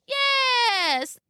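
very high, drawn-out fake granny; wolf as grandmother. A narrator's reading. Wolf answering Little Red eagerly, high fake voice. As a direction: squeal it out high and long.

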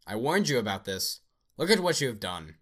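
loud, high, accusing yell; furious shout. Acted. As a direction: blast the blame at him at full volume. Dead friend accuses Anderson.

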